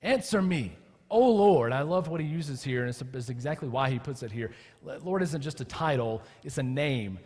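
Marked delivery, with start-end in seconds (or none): 0.0-1.7 higher, louder insistent demand. Acted. Psalmist demanding God answer him, forceful. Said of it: "That's a demand".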